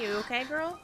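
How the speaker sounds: normal talking voice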